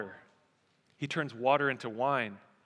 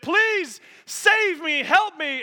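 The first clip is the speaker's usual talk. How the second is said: loud, high, drawn-out cry for help; hammed-up desperate begging; panicked plea; 12 semitones higher, 8 dB louder, much slower than usual. Government man fleeing a bull begs the farmer, panicked.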